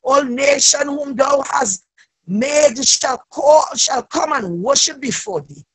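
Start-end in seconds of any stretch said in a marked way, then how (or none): none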